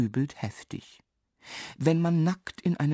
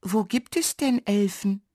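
high little dwarf voice; high-pitched curious question; speaking up; 8 semitones higher than usual. A narrator's reading. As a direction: ask aloud, in a small high voice. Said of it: "und laut fragt er".

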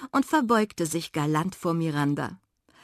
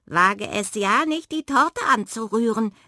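angry badger, raised and louder; angry call; 5 semitones higher, 5 dB louder than usual. A narrator's reading. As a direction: warn the thief off sharply. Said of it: "rief der Dachs erzürnt". (erzürnt gerufen)

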